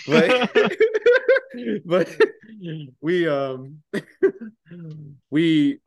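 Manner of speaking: laughing through the words; helpless giggling mid-story; real laughter. Real, not acted. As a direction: breaks up laughing. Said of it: "laughing at own story".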